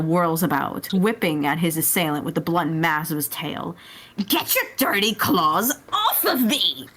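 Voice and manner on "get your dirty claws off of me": a high, laughing snarl of indignation; furious Frieza. Acted. Frieza shrieks at Turnip to get off, indignant. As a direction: spit it at the clawing Saiyan. Frieza yowling at his attacker.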